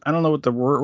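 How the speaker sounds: ordinary delivery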